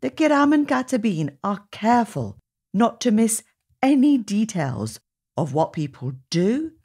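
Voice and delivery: usual speaking voice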